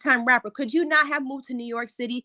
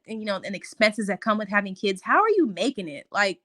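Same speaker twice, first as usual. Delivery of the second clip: ordinary talk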